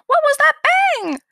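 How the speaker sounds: high, loud mock-startled outburst; startled cry. Mimics Janet's startled movie line.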